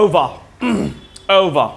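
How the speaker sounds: "over" said the American way as "uva"; American English accent. Shown as a demonstration. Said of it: "যেটা আমরা বলে থাকি ওভার".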